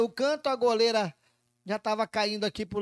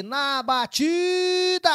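excited call; 8 semitones higher, 4 dB louder, much slower than usual. Real, not acted. Penalty kick commentary build.

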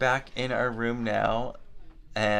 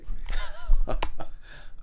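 giggling; 4 dB louder than usual. Real, not acted. Laughing during retake.

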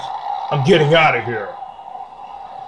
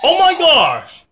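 surprised; 8 semitones higher, slower than usual. Real, not acted. Sudden rain in the game.

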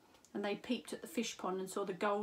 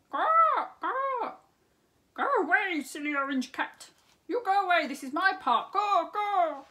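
much higher, louder squawking shove-off; squawking peacock; 9 semitones higher, 10 dB louder than usual. Acted. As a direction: squawk the cat off your park. Peacock shooing the cat.

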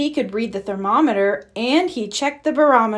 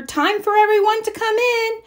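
calling out; 13 semitones higher, 3 dB louder than usual. Acted. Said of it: "called his mother".

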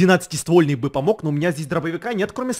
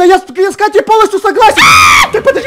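laughing shout; 12 dB louder, faster than usual. Acted. Panicked shouting at zombies.

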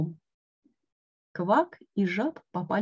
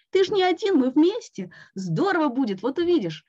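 encouraging; 7 semitones higher, 3 dB louder than usual. A narrator's reading. Frog encouraging scared Toad.

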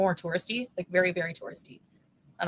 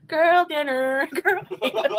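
higher, drawn-out sing-song; playful catchphrase voice; 7 semitones higher, 5 dB louder, slower than usual. Pretend. Joking about girl dinner, playful high voice. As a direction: stretch it out high and break into laughter.

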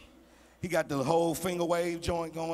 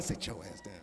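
much lower and softer stern command; mama's low hiss; 10 semitones lower, 9 dB quieter than usual. Retold or imitated. Mama in choir stand hushing her son, stern low. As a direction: shut him down through clenched teeth. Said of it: "My mama in the choir stand".

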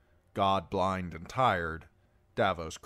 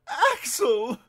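high, loud desperate cry; 9 dB louder than usual. A narrator's reading. Prisoner appeals to the knight behind him, desperate. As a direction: call out in desperation, voice pitched way up. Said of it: "the prisoner said desperately".

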